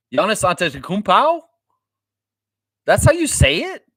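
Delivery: high, loud disbelieving question; as if he can't believe it; real surprise. Real, not acted. Streamer stunned by the correct pronunciation, genuinely surprised.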